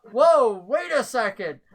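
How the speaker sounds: startled interjection. Acted. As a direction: halt everyone with a drawn-out whoa. Game master voices Claymore interrupting.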